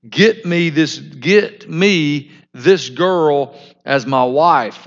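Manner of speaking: a spoilt son demands a wife, slowly; demanding son. Acted. Shechem ordering his father, demanding and slow. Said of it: "look at this".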